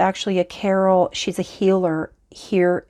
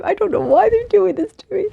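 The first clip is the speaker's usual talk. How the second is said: high, giggly complaint; half-laughing, pleading with the spirits to stop; laughing plea; 13 semitones higher, 5 dB louder than usual. Real, not acted. A laughing plea to the spirits teasing her.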